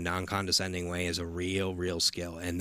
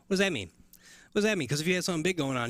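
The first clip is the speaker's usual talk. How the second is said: rising exasperated question; incredulous, pitched up high; 8 semitones higher, much faster than usual. Real, not acted. Exasperated rant about teasers.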